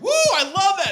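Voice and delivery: high, loud whooping imitation; put-on high cheer of delight; women cheering. Women in audience applauding the joke, cheering. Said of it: "a lot of women are like".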